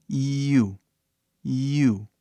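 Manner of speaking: "You" begun on a clear ee, gliding to oo; starts with I. Shown as a demonstration. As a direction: draw "You" out from an ee sound. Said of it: "Você começa com I". ("You" começando com som de I)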